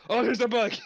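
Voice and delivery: high, loud yelp of mock panic; freaking out. Waiter staging a fright to make her aghast, freaking out. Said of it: "and freaks out".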